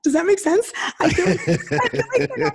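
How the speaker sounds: high, laughing through the words; flustered laughing. Real, not acted. Guest fumbling a touchy answer about women, flustered laughing. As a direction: break into an embarrassed laugh while checking.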